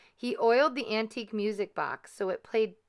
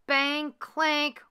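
high, loud mock "bang clank" like chains; playful sound-effect clank; 8 semitones higher, 3 dB louder than usual. A narrator's reading. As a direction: voice a ghostly clanking noise. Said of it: "went Gus on his bang clank equipment".

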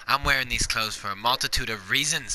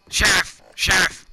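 calling out; 5 semitones higher, 4 dB louder than usual. Pretend. Calling out to the sheriff.

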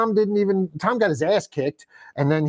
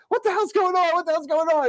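alarmed guy; 11 semitones higher than usual. Retold or imitated. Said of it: "They get inside and, like".

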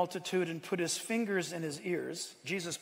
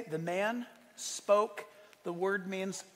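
ordinary talk

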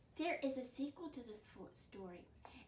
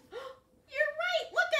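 high, loud gasping delight; delighted exclamation; 14 semitones higher, 10 dB louder, much slower than usual. Real, not acted. Praising the kids' guess with a high delighted gasp.

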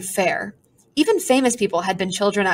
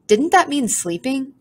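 ordinary delivery, nothing marked

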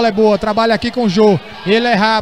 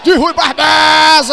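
shouted goal call; 3 semitones higher than usual. Real, not acted. Goal called in live commentary.

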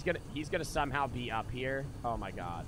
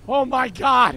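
much higher, loud panicked shriek; terrified scream; 10 semitones higher, 16 dB louder, slower than usual. Real, not acted. Streamer shrieking as the monster jumps out. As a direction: scream it, genuinely scared. Jump scare in horror game.

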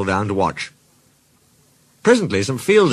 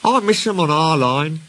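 wistful, higher engine voice; higher-pitched storybook longing; 6 semitones higher than usual. A narrator's reading. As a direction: say it with mild longing. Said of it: "he went on wistfully".

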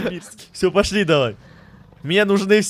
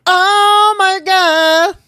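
high, loud squeaky exclamation; funny high voice; 9 semitones higher, 5 dB louder, much slower than usual. Shown as a demonstration. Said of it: "А ещё раз можешь?". (писклявое «о май гад»)

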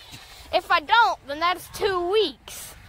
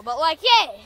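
ordinary talk

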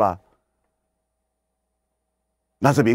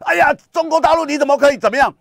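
much higher, louder whiny outrage; mock indignant protest; 5 dB louder, faster than usual. Commentator mocks Hong Kong critics, high whiny protest. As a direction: mimic a protester's shrill complaint. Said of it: "今天可以出来讲".

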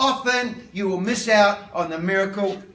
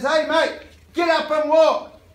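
a light Australian "mate" slant, a bit higher and louder; breezy Australian bloke tossing off an order; Australian accent; 5 semitones higher, 4 dB louder, slower than usual. Pretend. Peter in the mock version, casual Australian accent. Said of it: "Oh, he's Australian".

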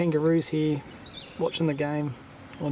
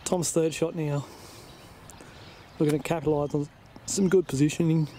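golf commentator; 5 dB quieter than usual. Acted. S0 commentates the shot.

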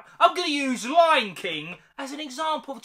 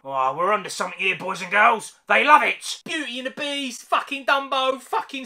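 a hint of a smug boardroom boss; lightly voiced excited exec rubbing hands; mock executive; 4 dB louder than usual. Disney executive in the boardroom, lightly mocked and smug.

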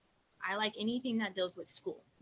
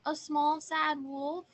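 high little voice, raised in volume; small sad wolf; 7 semitones higher, 4 dB louder, slower than usual. Acted. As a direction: play a little wolf at the door. Little wolf answers at the door.

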